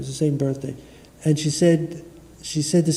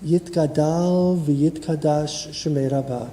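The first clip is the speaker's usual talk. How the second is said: slow, measured prayer recitation; Kaddish recitation; slower than usual. Acted. Rabbi leading mourners in the Kaddish, slow prayer chant. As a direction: lead the mourners through the Kaddish, unhurried.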